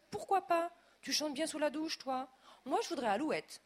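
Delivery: high, loud eager demand; insistent. A narrator's reading. Wife demanding a singing toilet, eager insistence. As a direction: press him like a spoiled guest.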